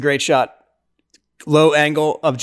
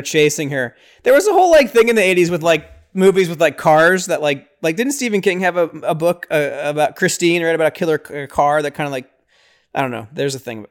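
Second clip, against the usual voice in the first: ordinary delivery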